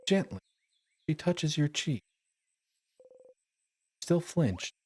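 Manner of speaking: usual speaking voice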